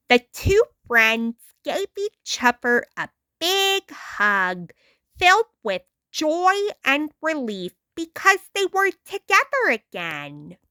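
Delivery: usual speaking voice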